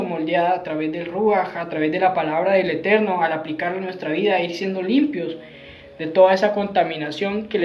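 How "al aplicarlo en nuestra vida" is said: ordinary talk, nothing marked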